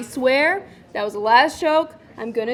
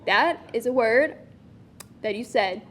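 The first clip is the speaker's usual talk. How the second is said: usual speaking voice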